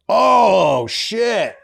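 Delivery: high-pitched imitation of a startled dad; mock fatherly astonishment; impression of dad. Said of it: "He sounds like this".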